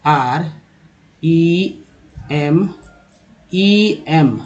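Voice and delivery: spelling slowly. Shown as a demonstration. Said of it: "एक word ले लेते हैं रिमेंबर".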